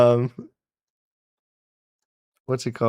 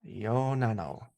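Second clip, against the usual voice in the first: imitated TTS accent; 2 semitones lower, 11 dB quieter, slower than usual. Streamer echoing the donation voice, imitated TTS accent. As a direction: quietly copy the text-to-speech accent. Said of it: "I like the accent".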